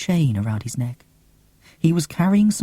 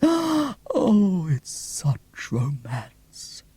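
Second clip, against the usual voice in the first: dreamy sigh; 4 semitones higher, much slower than usual. Acted. Swooning over the love story.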